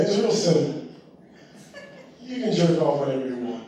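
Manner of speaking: ordinary talk, nothing marked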